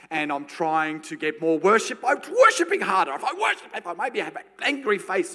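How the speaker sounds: loud, high mock effort; straining harder. Preacher mocking his own strained worship, loud. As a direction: push it with a hammed-up grimace.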